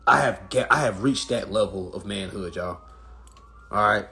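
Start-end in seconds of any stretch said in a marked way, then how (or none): none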